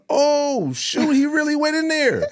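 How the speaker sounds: high, loud astonished cry; shocked exclaim. Retold or imitated. Seeing his friend bolt into the billionaires' room, astonished. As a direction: blurt it out in disbelief.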